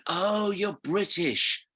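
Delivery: high, drawn-out "oh" of discovery; mock revelation, pitched well up and stretched; dawning realisation. Policeman realising the walker is British, mock dawning realisation.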